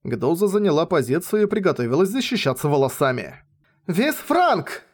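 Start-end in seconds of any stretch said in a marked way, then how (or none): 3.9-4.8 much higher, ringing battle cry; shouted spell. Acted. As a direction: yell the spell name. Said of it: "крикнула Амелия".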